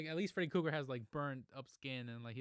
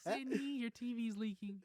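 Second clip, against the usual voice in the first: high-pitched movie quote; 10 semitones higher, slower than usual. Retold or imitated. Quoting a Scary Movie line.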